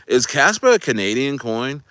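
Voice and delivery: normal talking voice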